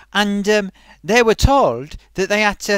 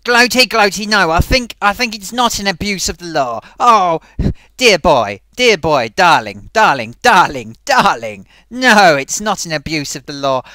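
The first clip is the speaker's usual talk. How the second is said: a pompous old thespian, hammed up; luvvie actor voice; 6 dB louder than usual. Thespian puppet dismisses Gloaty's worry, loud luvvie voice.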